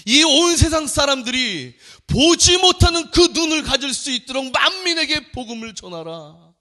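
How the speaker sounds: high, loud fervent proclamation; preaching at full force. Acted. Pastor urges the congregation to evangelize, fervent preaching. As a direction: cry out the command to the church.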